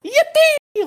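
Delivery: high, loud, squeaky Mario cheer. Retold or imitated. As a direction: belt it out like the plumber hero. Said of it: "runs around and goes".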